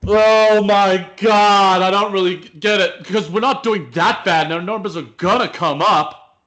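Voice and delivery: loud, high-pitched wail of disbelief; acted dismay. Acted scene, Michael learns of closure.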